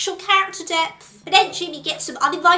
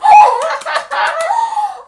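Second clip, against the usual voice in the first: evil cackle; 12 semitones higher, 7 dB louder than usual. Pretend. Cackle after wolf joke.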